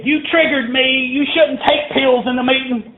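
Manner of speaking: high, indignant whine; whiny complaining voice. Retold or imitated. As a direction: squeak it out like an offended busybody. Offended member complaining after meeting.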